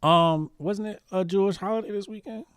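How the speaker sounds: mock innocent. Real, not acted.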